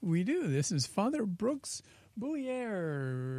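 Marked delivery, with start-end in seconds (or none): 1.2-3.4 "Boulier" drawn out slowly; stumbling name attempt. Real, not acted. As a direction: stretch out "Boulier" as a tricky surname. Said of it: "it's a difficult name to pronounce".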